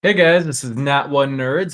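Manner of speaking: ordinary talk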